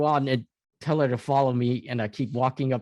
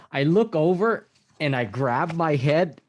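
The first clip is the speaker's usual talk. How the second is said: ordinary talk, nothing marked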